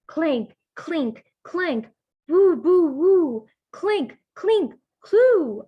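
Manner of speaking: high, loud clanking and wooing; spooky clanking noises. Acted. Storyteller making spooky bone clanks for kids. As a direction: ham up the ghostly clanks and woos.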